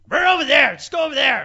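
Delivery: loud, high dismissive bark; curt retold bark. Rude staffer to a customer asking for tickets, curt bark. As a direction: bark directions at someone you won't look at.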